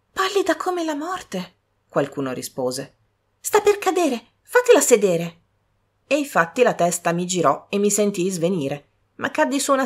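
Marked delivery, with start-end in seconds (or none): 0.1-1.5 put-on higher, slow young woman's voice; higher lightly voiced sister; young sister's voice. 3.4-5.4 higher, louder worried sister; urgent concern for the fainting girl; higher alarmed young woman. Acted.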